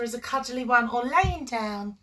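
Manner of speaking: normal talking voice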